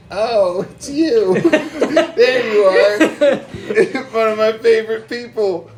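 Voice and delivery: mock sweet greeting. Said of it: "Be like".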